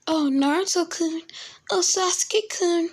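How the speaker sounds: very high, put-on fangirl voice; fawning girls. Girls surrounding the two boys, high swooning voice. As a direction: swoon over the boys in a squeaky-high girly voice. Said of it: "they will be like".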